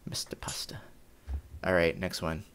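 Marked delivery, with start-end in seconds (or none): 0.1-0.8 whispered echo of a silly name; mock-solemn whisper. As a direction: breathe the name out, unvoiced. Echoing name in a hushed aside.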